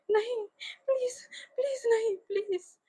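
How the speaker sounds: high, desperate begging; pleading. A narrator's reading. Wangji begs the ghost not to take him, high and desperate. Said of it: "गिड़गिड़ाहट".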